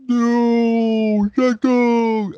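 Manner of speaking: high, loud mock cry of anguish; hammed-up movie yell. Mocking how the hero screams at the villain, hammed-up and high. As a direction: overact the hero's scream. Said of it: "it sounds horrible".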